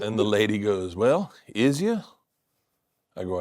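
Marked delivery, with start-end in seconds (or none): 0.9-2.0 Southern drawl. Retold or imitated. Clerk with Southern dialect.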